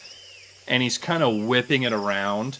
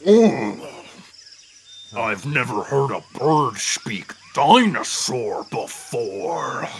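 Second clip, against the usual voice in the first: pompous dinosaur king; 4 dB louder than usual. Acted. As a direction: boast like a lordly tyrant lizard. GM voices the T-Rex king.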